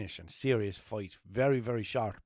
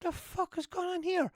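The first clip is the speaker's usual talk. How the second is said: baffled thought. Retold or imitated. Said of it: "I was thinking".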